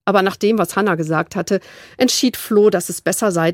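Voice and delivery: usual speaking voice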